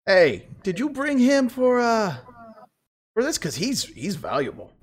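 high, slow, eager schemer; high excited voice. Acted. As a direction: call out brightly like a sly dealer. GM voices Jocelyn.

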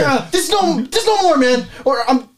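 high, worked-up ranting; frantic angry outburst; heated yelling. Acted. Acted shouting match.

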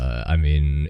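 ordinary delivery

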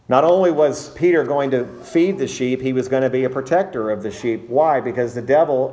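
ordinary delivery

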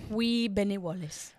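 weak, slow fake Scottish accent; hammy Scottish accent, drawn out; bad Scottish accent. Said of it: "That was a bad Scottish accent".

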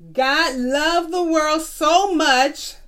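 put-on slow, word by word, for children to repeat; slow dictation. Shown as a demonstration. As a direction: spell each word out slowly so the class can follow. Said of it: "I'm gonna read it slow".

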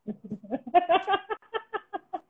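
sudden high-pitched laugh; high burst of laughter. Real, not acted. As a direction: break into giggles. Laughs at partner arriving. (gargalhada aguda)

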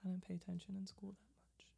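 fast whispered aside. Real, not acted. Friend admitting ignorance in a whispered aside. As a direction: murmur it like a confession.

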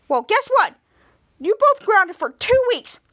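imitated parent handing out a sentence; parental scolding. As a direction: lay down the punishment with force. Parent grounds both children.

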